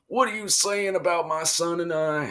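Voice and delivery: high, put-on indignant challenge; mock outrage. [name] challenges the man insulting him, mock outraged.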